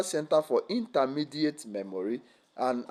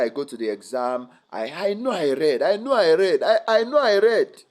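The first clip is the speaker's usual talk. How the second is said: protesting student; 4 semitones higher, 7 dB louder than usual. Retold or imitated. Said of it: "I hear people say".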